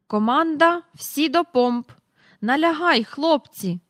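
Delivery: high, loud naval orders; commanding call. A narrator's reading. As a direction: call orders to a crew like an admiral. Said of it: "долинув від парку добре знайомий голос".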